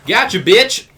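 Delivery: high, loud imitation of a catchphrase; mock catchphrase shout. Host mimicking Boonk Gang for co-hosts, loud mock shout. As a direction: holler it like a hyped-up catchphrase.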